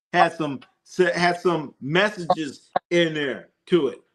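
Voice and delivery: ordinary talk, nothing marked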